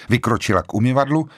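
ordinary delivery

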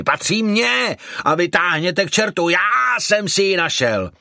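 high, threatening claim of ownership; menacing roar. A narrator's reading. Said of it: "zařval muž, ... Výhrůžně se obracel". (výhrůžně, zvýšeným hlasem)

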